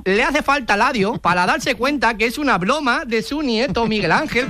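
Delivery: Spanish in a put-on Chinese accent, Ls for Rs; mock Chinese accent. Fake clerk in China confirming the purchase to a customer, mock Chinese accent. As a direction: play a caricature Chinese clerk. Prankster reveals joke, still in character.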